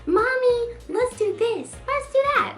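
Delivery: high-pitched, sugary child imitation; sweet little girl. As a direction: chirp it like an adoring daughter. Voicing the sweet little daughter.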